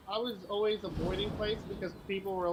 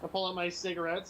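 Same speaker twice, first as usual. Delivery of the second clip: usual speaking voice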